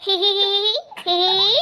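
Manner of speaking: spelled-out hee hee laugh; hee hee giggle. Acted. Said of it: "क्यों चिल्ला रही हैं".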